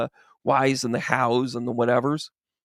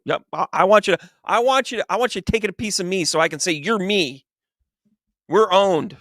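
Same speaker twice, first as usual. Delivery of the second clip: higher, tumbling, excited pleading; eager insistence; 5 semitones higher than usual. Acted. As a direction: push the idea with giddy enthusiasm. Adam insists excitedly to God.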